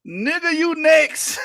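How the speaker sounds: slow mocking echo; slow punchline drawl. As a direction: stretch it out for the joke. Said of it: "repeats line as punchline".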